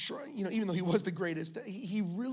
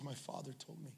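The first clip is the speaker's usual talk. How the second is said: soft, hushed; 4 semitones lower, 11 dB quieter than usual. Retold or imitated. Todd's tender word to the biker.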